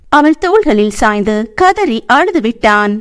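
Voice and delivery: usual speaking voice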